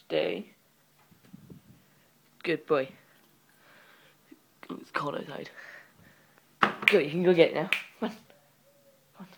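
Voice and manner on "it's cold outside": whispered aside. Real, not acted. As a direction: breathe it almost silently. Aside while training dog.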